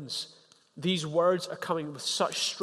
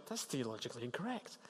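much softer, said only in your head; quiet inner thought; 3 semitones lower, 14 dB quieter, faster than usual. Retold or imitated. Thinking it about a suffering friend, very soft inner voice. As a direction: keep it to yourself, barely voiced. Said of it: "and you think".

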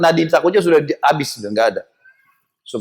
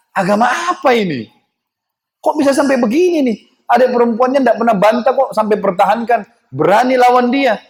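high-pitched, incredulous questioning as Umar; acted-out amazement, pitched up; astonished wondering; 8 semitones higher than usual. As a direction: voice his bewilderment, pitch raised. Acting out Umar's astonished thoughts.

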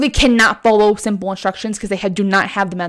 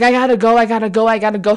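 mock panic; 4 dB louder, faster than usual. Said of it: "spazzing the fuck out".

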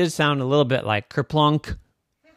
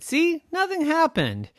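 high voice. Acted. Daddy reassures.

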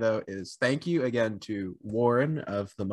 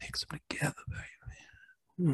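breathy seductive whisper; sultry whisper. Acted.

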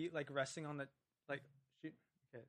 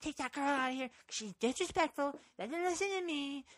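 much higher, louder complaining rant; ranting bus driver; 9 semitones higher, 6 dB louder than usual. Retold or imitated. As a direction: demand security like an unhinged driver. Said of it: "then the bus driver's like".

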